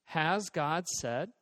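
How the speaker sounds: "Has God said" drawn out, rising at the end; slow, questioning "Has God said", lifted on SAID. Shown as a demonstration. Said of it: "was this question".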